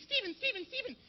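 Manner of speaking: very high, soft mock-girl whine; drawn-out, squeaky guilt trip; nagging vegetarian girl. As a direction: plead slowly in a tiny high voice. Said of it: "This girl, my friend, she's a vegetarian. She's always on me".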